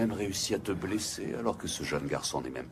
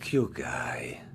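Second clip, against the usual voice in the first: cold whisper; 5 semitones lower than usual. Acted. Master coldly names a subordinate.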